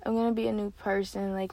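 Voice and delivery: usual speaking voice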